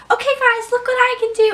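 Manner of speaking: high, excited show-off; show-off call. Retold or imitated. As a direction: call your friends to watch. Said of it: "I was gonna go like".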